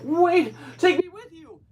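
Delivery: high, loud mock cry out; desperate cry. Stranded man shouting after the ship, high and loud. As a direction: yell after the leaving ship. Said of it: "and he's like".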